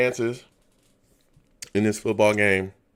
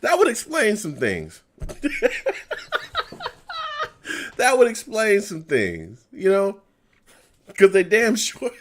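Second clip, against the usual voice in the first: higher, louder, laughing through the words; laughing agreement; 11 semitones higher, 6 dB louder than usual. Real, not acted. Fan laughing along with a viewer's joke about the Jets. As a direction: crack up at the joke.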